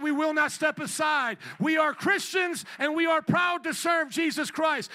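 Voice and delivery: loud, rousing preaching; rally speech. Real, not acted. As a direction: build it into a fiery rally cry. Preaching builds to full force.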